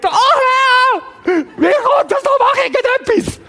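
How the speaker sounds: very high, loud, panicked wailing; hammy stage actor. Acted. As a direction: overact a desperate plea. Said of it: "Vielleicht nicht ganz so theatralisch".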